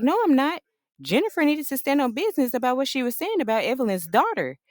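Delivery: defensive denial. Retold or imitated. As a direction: insist you did nothing wrong. Jackie defending herself to daughter.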